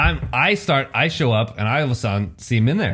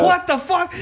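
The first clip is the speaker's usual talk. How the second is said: very high stunned squeal; shocked squeal; slower than usual. Retold or imitated. Retelling the moment The Rock walked off, high shocked squeal. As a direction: shriek it in disbelief. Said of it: "I'm like".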